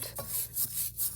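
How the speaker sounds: harsh cough clearing the lungs; deep cough. Real, not acted. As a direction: cough deep to get rid of the rust. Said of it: "You have to cough".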